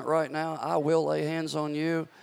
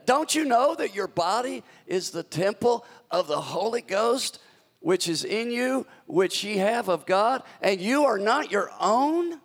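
higher, incredulous paraphrase; exasperated modern retelling; 5 semitones higher, 3 dB louder than usual. Shown as a demonstration. Preacher paraphrases Paul for today, incredulous. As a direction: voice it like you can't believe they forgot. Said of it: "This is how we would say it today".

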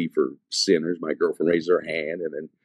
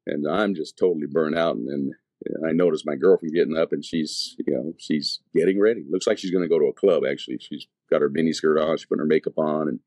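ordinary delivery

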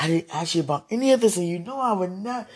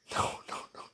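whispering; 5 dB quieter than usual. Real, not acted.

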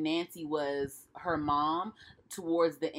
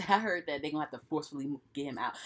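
ordinary delivery

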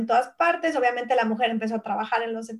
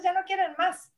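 normal talking voice

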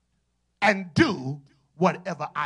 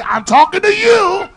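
much louder, higher, slow emphatic shout; loud pointed shout; 8 semitones higher, 13 dB louder, slower than usual. Shown as a demonstration. Preacher shows how to get somebody's attention, shouting. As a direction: point and proclaim it to someone far away. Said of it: "get somebody's attention and say".

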